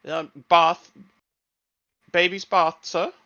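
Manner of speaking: chirpy robot butler. Acted. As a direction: offer help like an eager butler. Robot butler offers help.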